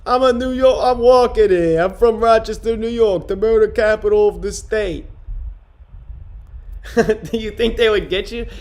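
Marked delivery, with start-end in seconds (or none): 0.0-5.1 loud, higher-pitched mock New Yorker; fake New York accent. Streamer mocking a friend from Texas, fake New York accent. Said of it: "talks in a fake New York accent".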